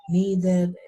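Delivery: "needed" with a full "ded", vowel like bed; pronounced as spelt. Shown as a demonstration. Teacher shows the learner's spelling-based "needed", full "ded" ending. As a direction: say "needed" as written, Spanish-style. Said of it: "se pronuncia tal y como se ve".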